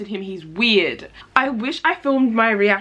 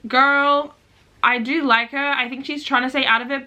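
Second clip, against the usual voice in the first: ordinary delivery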